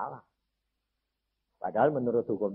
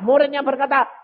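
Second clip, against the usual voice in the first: normal talking voice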